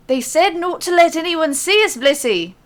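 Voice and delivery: a fan's bold try at a Scottish burr; Scottish accent, high and loud; thick Scottish accent. Acted. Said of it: "in a thick Scottish accent".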